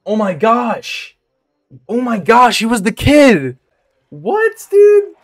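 high-pitched disbelief, climbing to a squeal; astonished. Real, not acted. Streamer discovers who played the kid, astonished. Stunned by discovering the actor's role.

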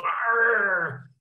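playful demo of the angry section; angry growl. Shown as a demonstration. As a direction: let out a short hammed-up angry roar. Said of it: "So the angry people, they go".

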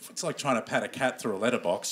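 ordinary talk

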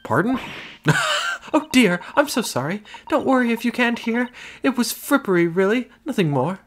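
high-pitched refined lady. Acted. Client talks to lawyer.

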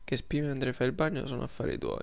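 usual speaking voice

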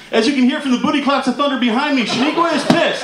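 high, urgent live weather report; hammed-up TV reporter in a hurricane; storm field reporter. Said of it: "they go live to Steve".

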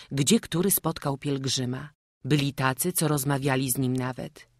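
usual speaking voice

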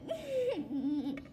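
laughing through happy tears; teary laugh. Real, not acted. Kid laughing and half crying over her toy. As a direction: let a choked, tearful laugh out. Overjoyed after unboxing.

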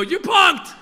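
Ashton Kutcher. Retold or imitated. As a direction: shout the prank reveal like a TV host. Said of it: "waiting for Ashton Kutcher to go".

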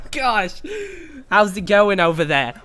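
a mock Australian accent for the intro greeting; hammed-up Aussie greeting, badly faked. Host greeting listeners in a failed Australian accent. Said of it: "I'm trying to be Australian with this intro".

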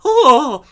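high, loud mock swoon; exaggerated high swooning gasp. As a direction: cry out like a smitten fan fainting. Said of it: "and they're like".

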